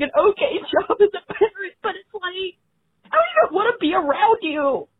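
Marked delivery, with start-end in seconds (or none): none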